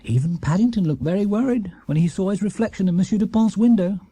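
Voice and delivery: plain reading voice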